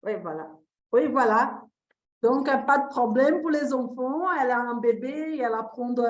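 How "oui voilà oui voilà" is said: ordinary delivery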